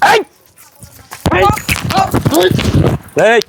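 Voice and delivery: yelling across the room. Acted. Street confrontation, shouting to stop.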